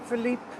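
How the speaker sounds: "Philippe" said the French way, fee-LEEP, stress at end; French pronunciation. Shown as a demonstration. Telling chat how his name is pronounced in French. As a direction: give "Philippe" a French sound, last syllable stressed. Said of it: "I prefer it pronounced Philippe".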